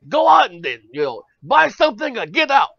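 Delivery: high, loud salesman's squawk. Retold or imitated.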